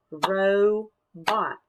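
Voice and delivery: RO, pause, BOT; syllable by syllable. Shown as a demonstration. Teacher demonstrating clapping to children, "Robot" split in two. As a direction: break "Robot" into two drawn-out beats. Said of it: "clap out parts of the words".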